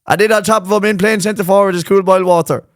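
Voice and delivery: high, sustained mock match commentary; Micheál Murray Hurtic. Naming the centre forward, playful commentator voice. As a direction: announce the line-up like a commentator. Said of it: "think of Micheál Murray Hurtic calling out his name".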